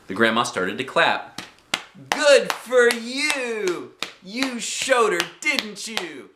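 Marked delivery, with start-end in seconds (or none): 2.1-6.3 put-on high, drawn-out old-lady cheer; yelled. A narrator's reading. Grandma cheers girl on from poolside, yelling. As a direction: yell congratulations slowly, pitched high. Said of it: "she yelled".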